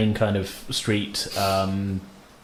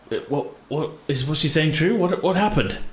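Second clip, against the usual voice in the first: rising, higher alarmed questions; shocked young guard; 6 semitones higher than usual. Acted. Young watchman to the party, higher and alarmed. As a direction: demand answers, growing alarm. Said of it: "is like".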